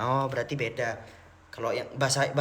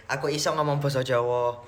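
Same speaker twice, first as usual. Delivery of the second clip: ordinary talk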